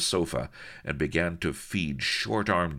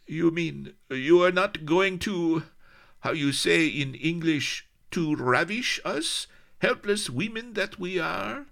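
high, loud proud declaration; high, loud accented noblewoman boasting her titles; haughty Spanish lady; 3 dB louder than usual. Acted. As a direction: flaunt every title with fire. Lady voice astonished.

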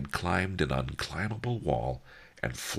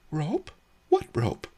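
higher, a touch of mock innocence; innocent Lotus Cloud; 12 semitones higher, 3 dB louder than usual. A narrator's reading. Lotus Cloud pretends not to know about the rope, mildly coy. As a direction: play dumb, lightly.

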